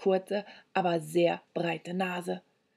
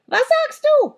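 very high, loud screech; piercing high demand; screamed question; 9 dB louder than usual. A narrator's reading. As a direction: shriek it like a deaf old crone. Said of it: "schrie die Alte".

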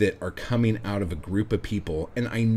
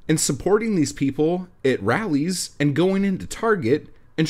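heated, raised ranting voice; angry rant; 7 semitones higher, 5 dB louder than usual. Real, not acted. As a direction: rant it out loud, fired up. Ranting at voters.

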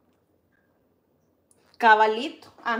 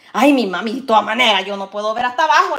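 mock protest to mom; 10 dB louder, faster than usual. Retelling her complaint to mom.

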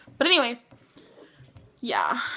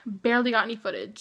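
usual speaking voice

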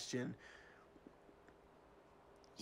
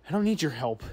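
loud refusal; 7 dB louder than usual. Acted. Fired employee rejecting boss.

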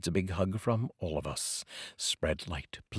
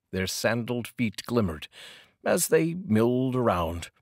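measured, unhurried poem reading with lingering pauses; poetry reading. Acted. Reads a poem aloud.

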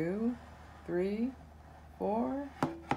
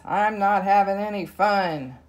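louder, sulky little cub's call; roaring complaint; 3 semitones higher, 7 dB louder than usual. Acted. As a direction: shout it across the grass like a fed-up cub. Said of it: "He roars across the grasslands".

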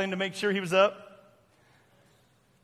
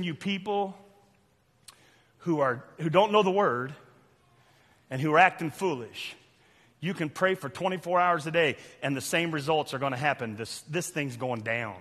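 ordinary delivery

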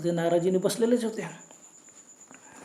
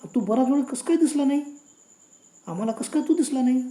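higher, louder puzzled demand; bewildered questioning; 5 semitones higher, 4 dB louder than usual. A narrator's reading. Husband asks driver why he was invisible, bewildered. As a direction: press for an answer, voice raised in disbelief.

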